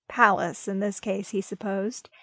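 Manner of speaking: plain reading voice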